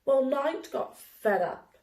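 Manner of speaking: usual speaking voice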